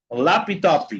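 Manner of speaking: laptop as "lapi-topi"; added final I. Shown as a demonstration. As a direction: say laptop Brazilian-style, an i after each p. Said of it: "agregarle una I a la sílaba".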